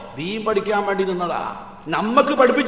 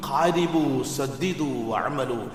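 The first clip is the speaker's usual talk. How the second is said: soft slow recitation; 7 semitones lower, 5 dB quieter, much slower than usual. Acted. Quoting the Prophet's words again.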